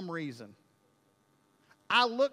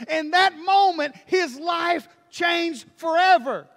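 loud, higher, slowing to a shout; preaching at full force; 7 semitones higher, 9 dB louder than usual. Acted. Preacher driving home the changed life, shouting. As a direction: build the sermon to its peak.

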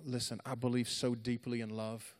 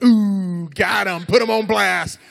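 very loud, high hollered taunt; mock crowd shout; heckling jeer; 8 semitones higher, 20 dB louder, slower than usual. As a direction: imitate a rowdy onlooker whooping.

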